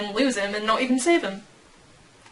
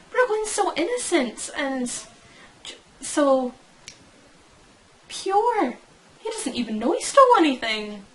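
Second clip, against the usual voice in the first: higher, cooing defence of a favourite; high-pitched gushing; 4 semitones higher than usual. Real, not acted. Fan defending innocent Brooklyn, high and gushing. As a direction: gush over someone innocent, pitched up.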